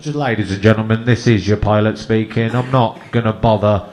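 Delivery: low, loud pilot over the intercom; a sacked pilot announcing to passengers, low and loud; pilot announcement. Retold or imitated. Captain announcing to passengers, low and loud. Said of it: "Imagine his next announcement".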